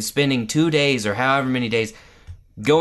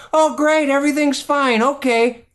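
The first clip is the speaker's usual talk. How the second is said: high, louder sing-song dismissal; mock casual cheer; 12 semitones higher, 4 dB louder than usual. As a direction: parody a parent breezily waving it off. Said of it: "such a casual reaction to it".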